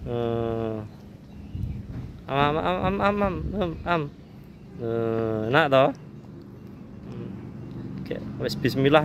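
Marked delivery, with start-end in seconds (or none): 2.3-4.1 nom nom. Pretend. Feeding the child, cooing.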